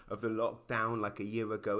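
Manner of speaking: ordinary delivery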